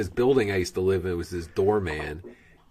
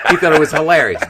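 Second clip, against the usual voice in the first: ordinary talk, nothing marked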